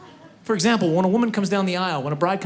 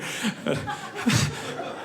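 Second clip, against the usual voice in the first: high llama squeal; 13 semitones higher, 7 dB quieter than usual. Pretend. Llama noise.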